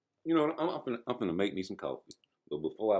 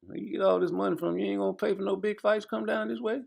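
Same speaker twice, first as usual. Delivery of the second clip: high mocking voice; 13 semitones higher than usual. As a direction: voice jumps way up, incredulous. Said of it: "they wondering like".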